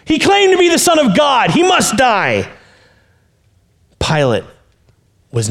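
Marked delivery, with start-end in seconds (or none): 0.0-2.5 high loud shout; crowd shouting. Acted. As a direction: yell the accusation. Crowd demands death.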